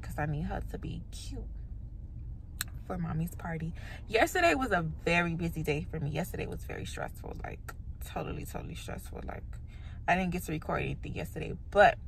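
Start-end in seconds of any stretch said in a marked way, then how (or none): 0.0-3.7 whisper. Real, not acted. Whispered aside in car. 6.1-9.4 weary hushed voice. Real, not acted. Weary complaint about stressful day.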